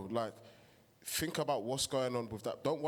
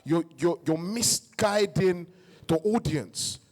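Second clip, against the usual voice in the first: louder, heated and driven; real passion; 3 semitones higher, 7 dB louder than usual. Real, not acted. Host apologizing to viewers, then louder heated passion. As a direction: pour real feeling into the point, getting louder. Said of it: "sorry, guys, I'm passionate".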